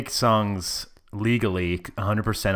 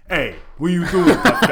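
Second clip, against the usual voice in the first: higher, louder laughing New York tough-guy voice; hammed-up New York street guy; 7 semitones higher, 7 dB louder than usual. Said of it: "the stereotype of".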